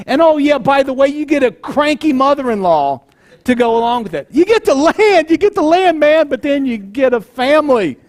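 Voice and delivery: spring the catch on him with glee; mock exclamation. Real, not acted. Mocking the deal's fine print.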